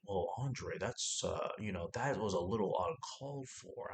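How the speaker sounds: a hint of a prim scold; mock scolding guy. Guy in background scolding Andre, low mock disapproval. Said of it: "some other guy in the background is like".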